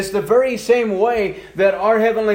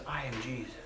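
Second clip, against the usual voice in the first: low, much softer, grave and deep; voice of God; 8 semitones lower, 19 dB quieter, faster than usual. Acted. God answering Saul on the road, low and hushed. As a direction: sink low and soft, a voice from heaven.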